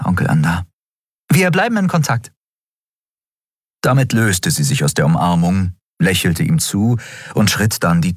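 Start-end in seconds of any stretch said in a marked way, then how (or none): 1.3-2.3 lightly raised voice for the captain Endriel; a wry young captain, higher than the narration; Endriel voiced. Endriel's dialogue voice.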